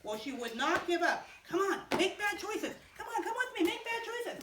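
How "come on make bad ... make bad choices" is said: coaxing temptress. Acted. Wife tempting Joseph again.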